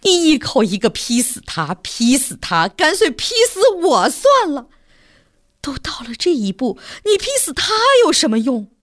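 sharp, rising rebuke with a hushed middle; stern scolding. A narrator's reading. Mother rebukes her son for his threats, scolding. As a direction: scold him down like an exasperated mother. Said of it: "叫他娘一训斥".

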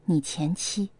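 soft, low self-correction; quiet, wry self-mockery; self-mocking. A narrator's reading. Girlfriend correcting her slip, lightly self-mocking. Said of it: "自嘲地笑笑说".

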